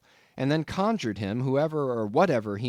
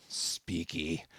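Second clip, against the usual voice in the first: 1 growled; 6 semitones lower, 7 dB quieter than usual. A narrator's reading. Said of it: "again growled the cannibal".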